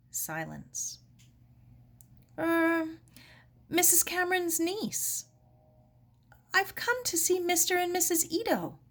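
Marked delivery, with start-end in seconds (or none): 2.4-8.8 put-on high, hesitant young girl; a girl explaining herself politely; timid girl voice. Sarah explaining herself to Helen through the door, high and hesitant.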